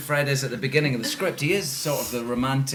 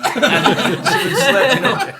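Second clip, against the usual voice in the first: high, slow, loud laughing words; 12 semitones higher, 10 dB louder, slower than usual. Real, not acted. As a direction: laugh through the line. Laughing about Betty slipping.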